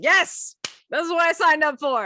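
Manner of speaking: loud, high, gung-ho yes; retold over-confident agreement, punched out; emphatic bluff. Guest bluffs to the mom about the goat hike, loudly emphatic.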